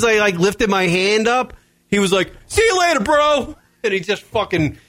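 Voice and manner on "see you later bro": high, mocking teen kid voice. Host imitating the bully kid leaving, high and bratty. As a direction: throw off a bratty high-pitched goodbye.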